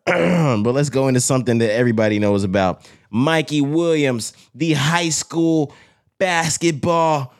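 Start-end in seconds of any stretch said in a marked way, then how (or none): 3.1-7.3 slow, high sports hype intro; hype announcer. Acted. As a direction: drawl it out like a TV promo. Hyping the next topic.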